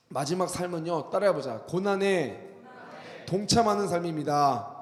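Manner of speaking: loud, ringing pulpit delivery; forceful preaching. Acted. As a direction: preach it out to the congregation, building to each amen.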